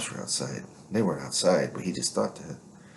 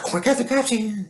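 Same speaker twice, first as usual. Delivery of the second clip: much higher and louder, faked panic; mock alarmed woman; 6 dB louder than usual. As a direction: act out her fake scare. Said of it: "It's like".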